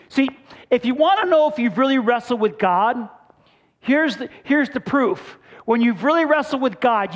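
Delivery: full-force preaching. Acted. Pastor urging his congregation toward transformation, preaching hard. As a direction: build it up and hammer each line.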